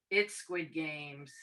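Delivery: normal talking voice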